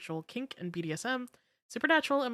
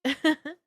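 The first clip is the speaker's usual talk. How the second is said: cartoon laugh; 8 semitones higher, 6 dB louder than usual. Real, not acted.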